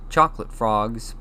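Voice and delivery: ordinary delivery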